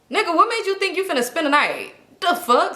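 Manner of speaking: indignant. Real, not acted. Teller telling viewers about his overnight hint, indignant. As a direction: call out his nerve. Outraged aside to audience.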